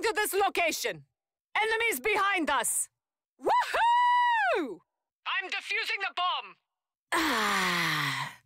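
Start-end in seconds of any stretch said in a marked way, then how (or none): 3.4-4.8 very high, loud whoop; excited cheer. Acted. Game hero celebrating a win, loud high whoop. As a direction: whoop like you just won the round.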